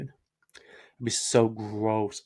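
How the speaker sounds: very slow, stretched disgust; slowly savoring the gross idea; disgusted drawn out. Real, not acted. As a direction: drag it out, grossed out. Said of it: "That'd be so gross".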